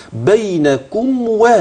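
بينكم و drawn out slowly with a clear kaf; بينكم و ببطء مع وضوح الكاف; slow recitation demo. Shown as a demonstration. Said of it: "الكاف معايا ملاحظها".

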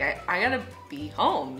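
ordinary talk

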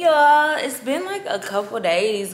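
normal talking voice

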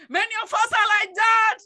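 loud, high repeat to the congregation; emphatic shout. Real, not acted. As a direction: raise the voice and drive it home. Same words repeated as a shout.

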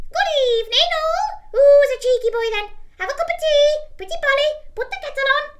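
very high, loud squawking parrot. Retold or imitated. Talking parrot greets skeletons, very high squawk. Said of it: "the parrot's saying".

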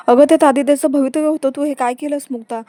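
mock rage at the torn papers; angry outburst. Savni yelling at Mukta over torn papers, angry.

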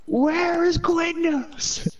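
very high and very slow whine; drawn out whine. Retold or imitated. Retelling the sign spinner moment, a drawn-out high whine. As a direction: stretch every word like a desperate kid. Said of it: "repeats the Quiznos quote".

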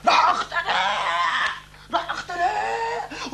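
high, slow laughing yell of I'm suffocating; mock choking cry. Son retelling father's nightmare, mock choking cry. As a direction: ham up the choking panic. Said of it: "كنت عم تقول".

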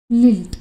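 teacher repeating the word लिल्ट; word repeated for students. Shown as a demonstration. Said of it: "with a Scottish lilt".

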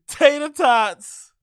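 slow, high, drawn-out words through laughter; laughing. Real, not acted. Repeating the funny order to chat, laughing. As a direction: stretch it out while cracking up.

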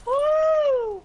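very high, loud victory whoop; excited whoop. Real, not acted. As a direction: whoop like you just scored. Celebrating a kill in game.

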